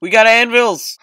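loud, high excited yell; excited shout. Real, not acted. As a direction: blurt it out thrilled.

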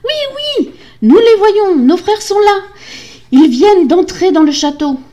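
very high, loud, joyful shouting; excited cries. A narrator's reading. Sisters spotting their brothers, excited shouts. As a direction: cry out the good news. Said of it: "Ses sœurs s'écrièrent".

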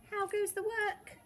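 high-pitched farmer's call. A narrator's reading. Farmer calling to the tired duck, high call.

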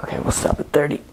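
airy, effortful gasping words; breathless strain. Real, not acted. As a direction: push words out through the strain. End of long hang, exhausted.

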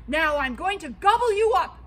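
loud booming troll threat; troll threatening the second goat, loud. Acted.

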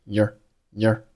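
your reduced to a short year; weak form of your. Shown as a demonstration. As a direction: let your fall to a light year. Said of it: "后面your弱读，读成了".

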